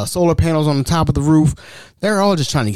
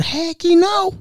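mocking voice; 6 semitones higher than usual. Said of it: "I said".

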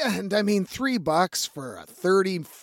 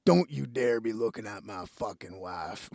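put-on grumpy old husband; 7 semitones lower, 8 dB quieter than usual. Jealous old men glaring at him.

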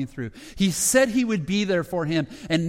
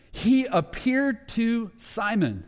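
drawn out very slowly, a little higher; slow and emphatic; 5 semitones higher, much slower than usual. Shown as a demonstration. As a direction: let each word land slowly, with weight. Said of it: "We have one line".

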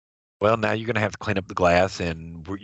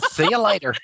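a higher, lightly voiced kid's flippant goodbye; mock kid; 9 semitones higher, 3 dB louder than usual. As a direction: echo the child's cheeky exit. Echoing the kid's brush-off.

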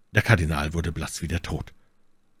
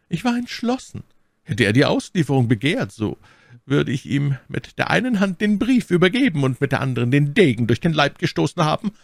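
much higher, fluting Aramis voice; 9 semitones higher, 4 dB louder than usual. A narrator's reading. Aramis answers in a fluting voice. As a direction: pipe it up like a flute. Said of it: "mit einer flötenartigen Stimme".

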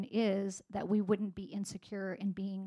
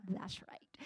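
whispered, teasing "That's right"; playful whisper; 6 dB quieter than usual. Retold or imitated. Mother confirming to her son, playful whisper. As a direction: breathe it like a shared secret.

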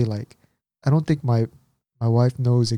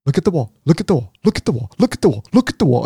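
rapid-fire repeated command; drill sergeant; 4 semitones higher, faster than usual. Retold or imitated. Nurse steering the husband into the room, drill-sergeant orders. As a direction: bark it fast on a loop, slightly raised. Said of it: "like a drill sergeant".

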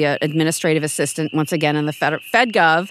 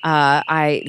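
usual speaking voice

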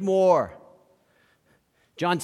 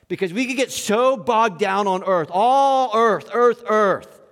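louder, rising preacher's build; fervent preaching; 4 semitones higher, 4 dB louder than usual. Acted. Preacher urging the congregation, louder and rising. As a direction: drive it home from the pulpit.

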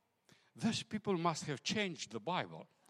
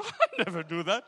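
laughing reply; 5 dB louder than usual. Retold or imitated.

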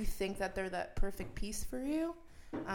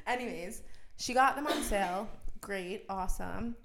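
normal talking voice

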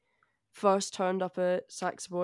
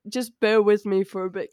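ordinary delivery

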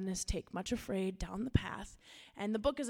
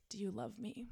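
gentle question; 8 dB quieter than usual. Acted. Shepherd gently asks Much Afraid.

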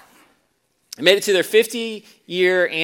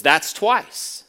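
firm but calm; 5 dB louder than usual. A narrator's reading. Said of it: "firmly but calmly says".

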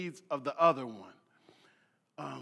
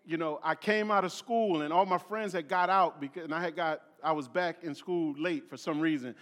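ordinary talk, nothing marked

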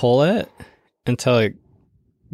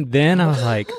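usual speaking voice

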